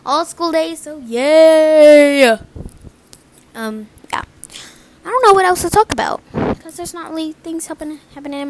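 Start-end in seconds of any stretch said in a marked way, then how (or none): 0.1-2.4 excited cheer. Real, not acted. Cheering about school. 3.5-4.2 whisper. Real, not acted. Sudden whisper.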